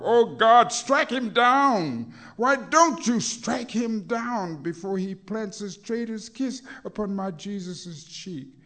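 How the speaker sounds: high, loud cry to God; anguished cry. Acted. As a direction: beg heaven to strike him down. Pleading against Judas' betrayal.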